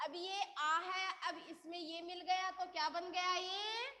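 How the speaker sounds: ordinary delivery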